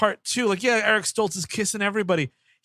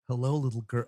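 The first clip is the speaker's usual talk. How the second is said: creepy whisper; 8 semitones lower, 6 dB quieter than usual. Retold or imitated. Creepy character voiced.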